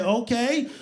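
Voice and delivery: put-on high-pitched clueless kid, rising endings; helpless, chirpy fast-food worker impression; Mike. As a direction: play the kid caught in the middle. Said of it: "Mike only says three words".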